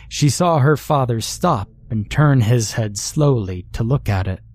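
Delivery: ordinary delivery, nothing marked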